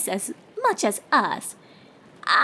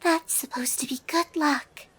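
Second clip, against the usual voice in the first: breathy, hushed explanation; shy whisper trailing off at the end; timid whisper; 5 semitones higher, 5 dB quieter than usual. A narrator's reading. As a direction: whisper so nobody is disturbed.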